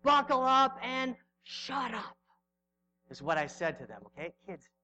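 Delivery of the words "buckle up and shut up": high, drawn-out yell, retelling an outburst; retold forceful yell. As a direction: re-enact yelling at the kids, slow and high. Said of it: "I was yelling".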